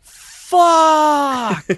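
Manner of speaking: high, loud retold yell; top of his lungs. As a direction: imitate a fan bellowing in despair. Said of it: "at the top of his lungs".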